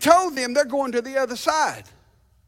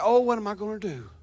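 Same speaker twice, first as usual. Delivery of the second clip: mock fretting; 5 semitones lower, 5 dB quieter, faster than usual. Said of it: "wringing your hands and say".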